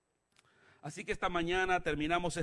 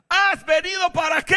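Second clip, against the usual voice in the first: very high, loud shout; demon's cry; 13 semitones higher, 11 dB louder than usual. Acted. Demon challenging Jesus before the congregation, crying out. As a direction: shriek the question as the demon. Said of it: "le dice el demonio".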